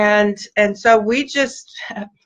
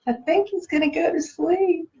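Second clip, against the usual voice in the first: higher and softer, cooing over the sleepy puppy; 6 semitones higher, 5 dB quieter than usual. Real, not acted. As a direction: coo it so he drifts off.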